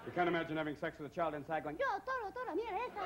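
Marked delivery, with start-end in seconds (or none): 1.8-3.0 put-on very high Spanish-accented little voice; Spanish-accented fetus. Baby inside the womb calling in Spanish, very high voice. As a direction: cheer like a tiny bullfighter inside.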